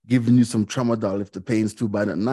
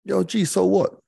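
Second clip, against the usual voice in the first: higher, brash and dismissive; blunt Mancunian mate; 9 semitones higher than usual. Retold or imitated. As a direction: brush off his self-pity like a blunt mate. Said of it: "he's from Manchester".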